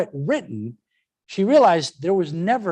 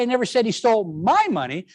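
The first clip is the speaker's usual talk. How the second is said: my punched, rest plain; stress on MY; 4 semitones higher than usual. Shown as a demonstration. Teacher showing it was someone else's money, stress on my. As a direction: lean on my and let the line rise. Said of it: "then I'm saying".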